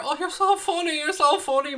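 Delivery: high, sneering mock praise; mock the joker with fake delight; sarcastic mockery. Mocking a hair insult.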